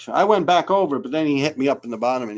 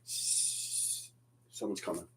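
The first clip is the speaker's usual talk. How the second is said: slow, breathy warning whisper; tense whisper; 8 semitones lower, 17 dB quieter, much slower than usual. Retold or imitated. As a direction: hush it like someone's near. Whispered tension while tagging.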